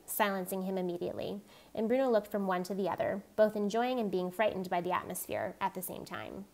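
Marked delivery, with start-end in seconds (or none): none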